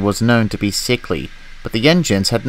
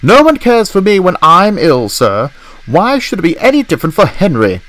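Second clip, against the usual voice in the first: higher, louder indignant complaint; fuming; 9 semitones higher, 5 dB louder than usual. Acted. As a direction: protest hotly to the boss. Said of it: "fumed Gordon".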